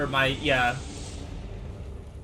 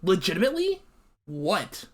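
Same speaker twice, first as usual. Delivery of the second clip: past anger, simply dumbfounded; incredulous, pitch climbing then dropping away; baffled disbelief; 2 semitones higher than usual. Real, not acted. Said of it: "now I'm just like".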